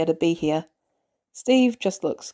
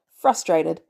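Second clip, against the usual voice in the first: usual speaking voice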